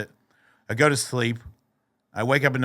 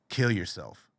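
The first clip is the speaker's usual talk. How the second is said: low, understated sinister voice; scary inner voice; 5 semitones lower, 4 dB quieter than usual. Retold or imitated. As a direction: lightly voice a dark thought. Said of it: "scary voice that I'm hearing".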